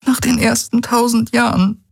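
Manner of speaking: high voice, sadly certain; Key, sad and sure. Acted. Key tells Galen he will forget her, high and sad. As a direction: tell him gently he will live on.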